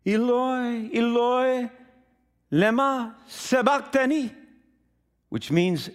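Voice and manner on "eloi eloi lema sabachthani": high, sustained, ringing cry; loud crying out. Acted. Jesus dying on the cross to God, crying out. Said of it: "cried out in a loud voice".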